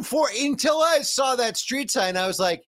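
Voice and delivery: ordinary talk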